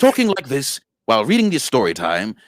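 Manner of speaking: posh British accent, read with a laugh; prim, posh British storyteller accent. Shown as a demonstration. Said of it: "in a posh British voice".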